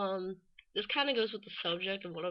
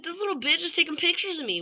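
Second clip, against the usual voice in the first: high, incredulous retold outburst; pitched-up, scandalized complaint; acted indignation; 8 semitones higher than usual. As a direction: react in mock disbelief at being photographed. Retold indignant reaction to photos.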